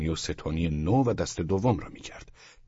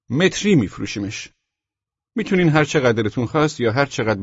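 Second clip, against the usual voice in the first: higher, louder shop seller; emphatic, pitched-up salesman; salesman character; 6 semitones higher, 6 dB louder than usual. Acted. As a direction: rattle off the sales terms in a raised voice. Seller makes his pitch.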